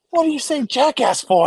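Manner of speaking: lightly hammed-up churchgoers telling off a child; scolding adults. Church adults scolding the kid for saying jackass, mock scold. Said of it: "They're like".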